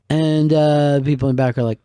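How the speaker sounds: ordinary delivery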